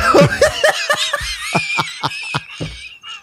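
squealing, breathless laughter; high shrieking laugh. Real, not acted. Laughing at the drawing.

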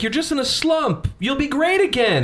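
high-pitched imitation of little Ricky; high voice quoting a movie kid; little boy Ricky. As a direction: pitch it way up as a child cheering on a hero. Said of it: "he's like".

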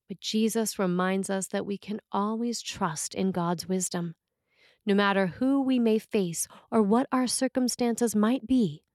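ordinary talk

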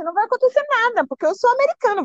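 high-pitched imitation of a dismissive boyfriend; retold taunt. Boyfriend's retort in retold quarrel. (provocação do namorado imitada em tom agudo)

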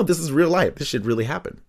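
usual speaking voice

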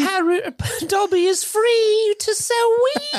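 very high, loud elf proclamation; Dobby impression. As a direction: squeal it slowly, breaking into laughter. Dobby exulting in his freedom.

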